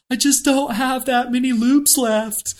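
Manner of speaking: high, slow imitated whine; mock whining. Onlooker mimicking her whining up the pitch, high slow mock whine. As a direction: whimper it like a worn-out climber begging for pity. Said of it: "you whining up there going".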